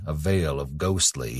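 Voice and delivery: ordinary delivery, nothing marked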